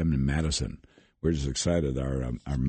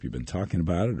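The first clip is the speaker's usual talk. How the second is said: normal talking voice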